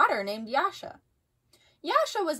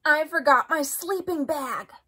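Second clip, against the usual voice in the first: dismayed cry; 4 semitones higher than usual. A narrator's reading. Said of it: "cried Froggy".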